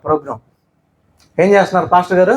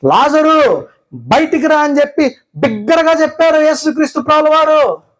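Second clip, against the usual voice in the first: full-force preaching; 5 semitones higher than usual. Acted. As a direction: preach it high and driving.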